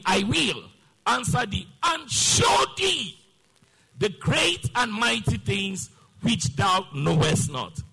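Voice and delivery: loud, drawn-out declaiming to a congregation; rousing sermon delivery, stretched key words; forceful preaching. Real, not acted. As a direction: raise the voice and hammer out the promise.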